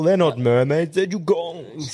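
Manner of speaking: put-on character voice, slowing on the last word; hammed-up correcting voice; Nigel impression. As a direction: do a cartoonish correction, drawing out the end. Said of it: "and then old Nigel".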